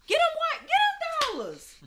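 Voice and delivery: high-pitched excited holler; hyped call-out. Real, not acted. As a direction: cheer it out high and drawn.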